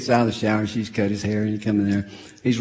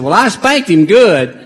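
mock wife voice; 9 semitones higher, 10 dB louder than usual. Wife's retold reply.

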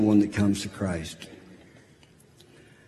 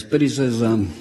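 ordinary delivery

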